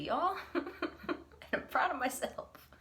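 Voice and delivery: usual speaking voice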